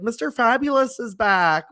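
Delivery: imitated high, sing-out welcome; Stacey. Star welcoming him when he came back in, imitated excited call. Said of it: "And then Stacey was like".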